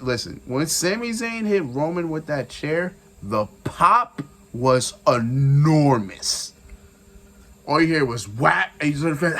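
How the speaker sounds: ordinary talk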